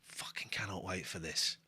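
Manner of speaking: hushed, breathless whisper of glee; whispered excitement. Real, not acted. Streamer whispering to the chat before the act, giddy. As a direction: whisper it like you can barely contain yourself.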